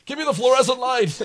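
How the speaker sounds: loud, high mock detective line; hammed-up TV cop. TV cop at a crime scene, loud and high. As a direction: bark it like a TV detective. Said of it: "Good Morning America's like".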